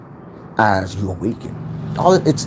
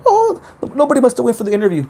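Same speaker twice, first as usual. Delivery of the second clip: high, fast mock-innocent dig; hammed-up insincere amazement, pitched way up; backhanded remark; 13 semitones higher, 3 dB louder, faster than usual. Narcissist belittling someone's promotion, backhanded and high-pitched. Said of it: "those, like, the backhanded ones".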